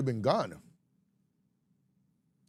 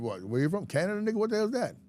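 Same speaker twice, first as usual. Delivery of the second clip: high-pitched scornful disbelief; mock incredulous; 8 semitones higher, faster than usual. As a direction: scoff in a mock outsider's voice, pitched up. Acted New Yorkers scorning Canadian origins.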